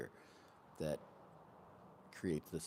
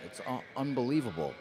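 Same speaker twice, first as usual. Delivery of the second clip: amazed; 5 semitones higher, 7 dB louder than usual. Real, not acted. Amazed at the stellium.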